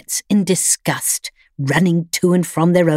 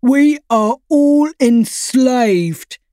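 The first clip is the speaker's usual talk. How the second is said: slow, much higher, louder proclamation; grave declaration; 7 semitones higher, 4 dB louder, much slower than usual. A narrator's reading. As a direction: announce it like a revolutionary. Freddy declares.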